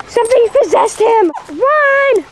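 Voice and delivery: high-pitched mock alarm; hammed-up horror, stretching into high cries; play-acted panic. Warning that the dog is possessed, mock panic.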